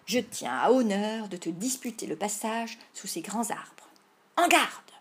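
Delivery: loud, theatrical play-acted duel talk; grand challenge, bursting out at the end; mock-heroic declaiming. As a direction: declaim like a storybook outlaw.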